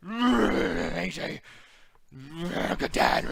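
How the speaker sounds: loud, quavering mock cry; mock shaky promo. Mocking Samoa Joe shaking on the mic with a quavering yell. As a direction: shake through the words like he's overwrought. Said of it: "he's just like".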